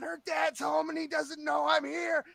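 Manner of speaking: high, urgent panicked voice; panicked explaining. Retold or imitated. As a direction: blurt it out mid-getaway. Acted panicked escape to driver.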